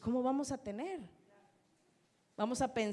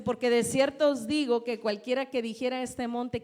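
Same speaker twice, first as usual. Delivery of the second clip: preaching scripture; 2 semitones higher, 7 dB louder than usual. Acted. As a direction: proclaim each word to the congregation. Preacher reading scripture aloud.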